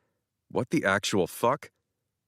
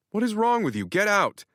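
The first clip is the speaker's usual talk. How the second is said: angry outburst; 12 semitones higher than usual. Acted. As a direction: throw him out in a rage. Narrator yells at Nigel.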